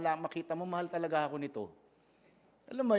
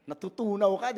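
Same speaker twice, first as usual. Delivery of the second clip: normal talking voice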